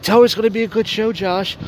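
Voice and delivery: a hint of a whiny pleading man; Roger's plea. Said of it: "And Roger's like".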